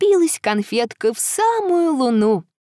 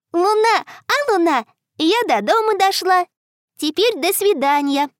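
put-on little girl voice; 6 semitones higher than usual. Girl says goodbye.

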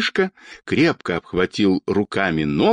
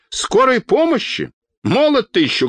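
high-pitched indignant retort; indignant cry; 12 semitones higher than usual. A narrator's reading. Old woman scolding the doctor from above, crying out. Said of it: "закричала Анна Петровна".

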